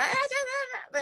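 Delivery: high-pitched mock nagging babble; mimicking a parent's shouting at home. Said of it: "when you're shouting and just being like".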